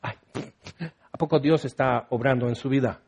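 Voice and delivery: low, quiet scoffing aside; acted-out scornful disbelief, tossed off softly; mock disdain. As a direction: imitate someone dismissing another with doubt. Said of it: "es muy fácil despreciar".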